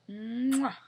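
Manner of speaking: big smacking kiss sound, high and loud; loud blown kiss. A narrator's reading. Snow Queen blowing a kiss at Sharon, loud smacking kiss. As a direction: blow a huge noisy kiss. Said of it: "puckering up her lips".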